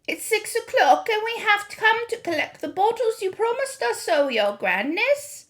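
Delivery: high, timid pleading witch voice; meek old witches. A narrator's reading. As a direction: grovel before their mistress.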